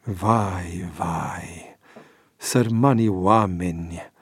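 low, hushed sorrow; sorrowful lament. A narrator's reading. George troubled by news of flood victims, sorrowful.

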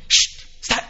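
urgent whispered shush; frightened hush. Retold or imitated. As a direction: hush the child as if danger is near. Parent hushing child in fear. (șoaptă speriată de părinte care liniștește copilul)

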